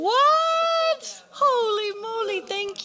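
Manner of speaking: sudden high shout of disbelief; shocked surprise. Real, not acted. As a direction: yelp it, totally caught off guard. Surprised everyone gets gift.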